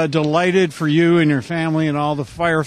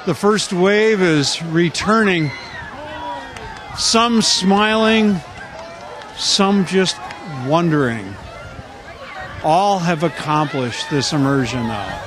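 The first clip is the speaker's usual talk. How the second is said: announcer calling over a noisy crowd; live event commentary, raised voice; live play-by-play; 3 semitones higher than usual. Real, not acted.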